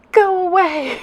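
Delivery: high, loud, overacted shout; overdone dramatic yell. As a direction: fling it at the wind, way too much. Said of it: "No, that was too extra".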